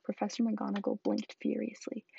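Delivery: ordinary delivery, nothing marked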